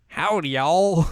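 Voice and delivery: mock Southern drawl greeting; hammed-up Southern howdy; southern accent. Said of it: "a southern accent".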